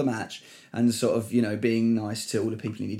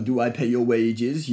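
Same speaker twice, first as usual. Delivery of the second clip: lightly voiced French accent, slow; a hint of Arsène Wenger's French accent, unhurried; Wenger impression; slower than usual. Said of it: "and I did some Wenger".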